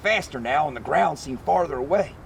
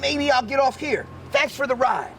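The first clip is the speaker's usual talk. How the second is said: lightly voiced nervous boy, a bit higher; the boy talking himself out of it, a touch higher; boy's voice; 7 semitones higher than usual. Boy on tiger's back hedging to get off, lightly voiced nervous boy.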